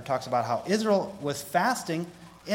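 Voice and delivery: ordinary talk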